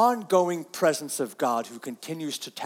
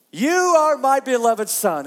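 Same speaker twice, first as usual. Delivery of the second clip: loud proclamation; 7 semitones higher, 6 dB louder, slower than usual. Shown as a demonstration. God's voice proclaimed loudly.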